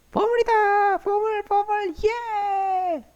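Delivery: high, thrilled cry; excited shout. Real, not acted. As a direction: cheer at the treasure. Treasure found, cheering.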